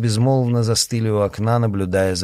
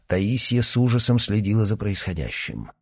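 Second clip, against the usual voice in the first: usual speaking voice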